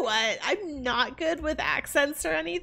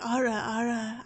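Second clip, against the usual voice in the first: "Ara, Ara" dropped to a deeper pitch. Shown as a demonstration. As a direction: try the catchphrase lower down. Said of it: "like, a deeper".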